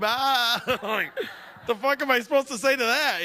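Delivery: ordinary talk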